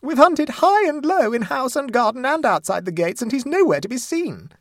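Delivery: loud, very high-pitched distraught wail; shrill. A narrator's reading. Mother tells of the fruitless search, shrilly. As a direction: sustain a shrill, frantic cry. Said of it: "continued Missus Mumby in a shriller tone".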